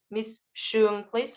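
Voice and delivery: ordinary delivery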